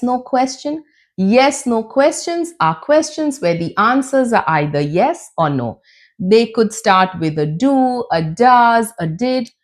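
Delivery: ordinary talk, nothing marked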